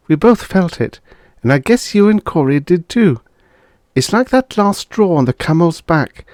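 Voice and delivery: wife Beth confiding in her friend; lightly voiced wife Beth.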